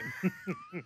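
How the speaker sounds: sudden high-pitched burst of laughter; high squeaky laugh. Real, not acted. Laugh after joke.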